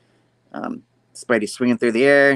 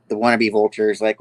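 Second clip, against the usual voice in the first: ordinary delivery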